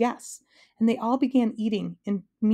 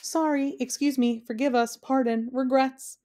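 string of higher-pitched little apologies; apologizing; 6 semitones higher than usual. A narrator's reading. Scolded guests answering the king in turn, apologizing. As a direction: apologize one guest after another, voice raised in pitch. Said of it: "They apologized in turn".

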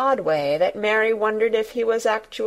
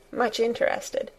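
plain reading voice

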